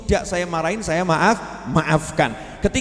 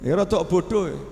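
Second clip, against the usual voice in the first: normal talking voice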